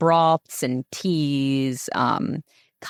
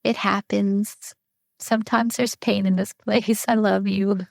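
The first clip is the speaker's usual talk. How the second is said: higher, tender comforting voice; soothing the baby; 5 semitones higher than usual. Retold or imitated. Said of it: "I'm just like".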